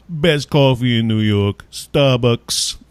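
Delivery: proud mock New Yorker verdict; hammed-up New York brag. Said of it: "that stupid, like, New York voice".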